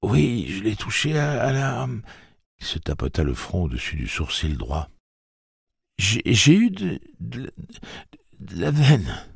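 put-on high boy voice, stammering and shaky; a scared boy fighting back tears. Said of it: "ses lèvres qui s'étaient mises à trembler".

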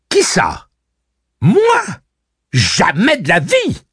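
high, loud, offended exclamations; indignant, loud denial; stung outcry. A narrator's reading. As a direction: cry out, cut to the quick. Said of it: "s'écria Cordelia, piquée au vif".

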